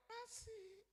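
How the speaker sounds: very high, drawn-out mom voice; gentle mother. Acted. Mother answering her son's worry, gently. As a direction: answer sweetly in a tiny high voice. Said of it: "Mama saya dengan lembut bilang".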